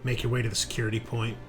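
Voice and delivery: usual speaking voice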